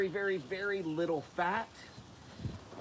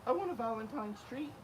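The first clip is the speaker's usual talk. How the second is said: begging dogs voice; 7 semitones higher than usual. Retold or imitated. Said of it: "They're like".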